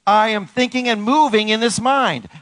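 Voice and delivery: high, forceful declaration; rallying affirmation. Shown as a demonstration. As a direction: proclaim it for all to repeat. Leading congregation in loud affirmations.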